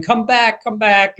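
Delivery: high, contained mock shout; retold shout. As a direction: imitate calling after someone swimming off. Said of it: "Lou's shouting".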